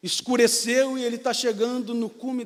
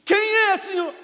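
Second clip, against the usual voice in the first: higher, louder, startled question; startled call; 10 semitones higher, 5 dB louder than usual. Acted. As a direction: call upward in sudden alarm. Climber calls out to God.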